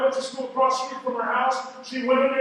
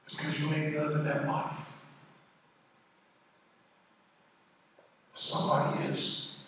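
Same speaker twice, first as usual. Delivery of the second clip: much softer, lower, intimate retelling; hushed storytelling; 10 semitones lower, 12 dB quieter than usual. Real, not acted. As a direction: drop the voice to a near hush for the painful memory. Long hushed painful childhood story.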